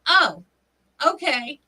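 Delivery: taken-aback sheriff. Retold or imitated. Retelling sheriff's answer at the door, taken aback. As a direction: imitate a man backing off, bemused.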